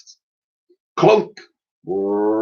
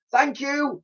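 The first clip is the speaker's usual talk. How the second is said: yelled back; 5 dB quieter than usual. Acted. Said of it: "she yelled back".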